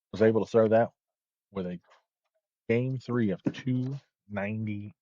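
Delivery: steady bowling broadcast commentary; sports commentary. Acted. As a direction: call the match like a TV commentator.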